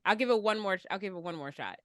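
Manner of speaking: ordinary delivery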